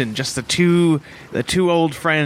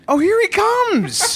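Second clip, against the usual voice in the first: mock surprise; slower than usual. Greeting the boat captain.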